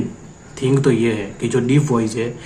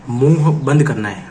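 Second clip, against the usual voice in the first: usual speaking voice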